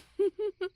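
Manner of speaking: short, loud, high snicker; a quick cruel snicker; mocking laugh. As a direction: snicker at their fantasy. Bully mocks the victim's coping.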